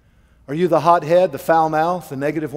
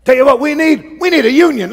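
louder, higher griping worker; loud mock rallying; 10 semitones higher, 10 dB louder, faster than usual. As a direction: whip up the break room, hammed-up. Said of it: "it's always like".

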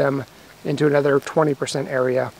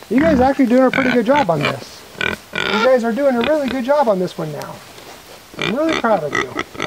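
praising animals; 5 semitones higher than usual. Real, not acted. Praising the pigs, high voice.

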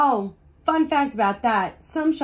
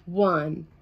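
usual speaking voice

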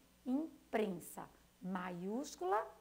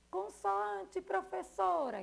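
put-on a higher, eager child answering the teacher; pupil's answer; 8 semitones higher than usual. Teacher playing a pupil answering her question, higher and eager. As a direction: chirp the answer back like a keen pupil.

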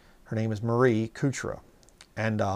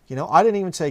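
ordinary talk

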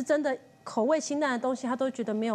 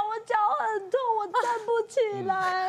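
put-on higher, tearful child's plea, slowing down; half-cried complaint of a child in pain; imitating a crying child; 8 semitones higher than usual. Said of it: "他是真的哭".

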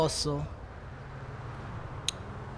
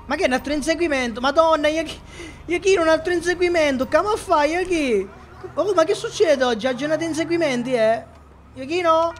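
excited amazement; 6 semitones higher than usual. Real, not acted. Amazed at another chase.

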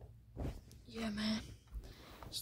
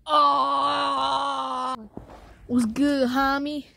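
excited shout; 4 semitones higher, 13 dB louder, much slower than usual. Real, not acted. Loud greeting shout.

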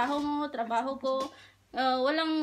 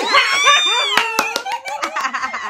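loud shrieking laugh; 12 dB louder than usual. Real, not acted.

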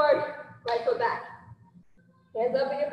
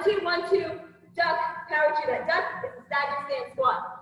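ordinary talk

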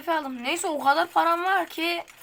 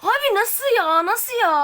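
slow, high, stunned outcry; stunned by the kill; disbelief; 6 semitones higher, 4 dB louder than usual. Real, not acted. Gamer outraged at a kill. (şaşkın isyan)

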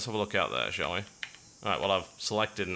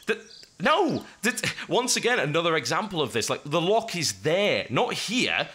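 exasperated outburst; 6 semitones higher than usual. Real, not acted. Frustrated outburst at game controls.

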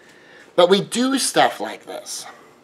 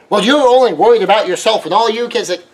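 accusing critic; 5 semitones higher, 4 dB louder than usual. Acted. Accuser diagnosing narcissism.

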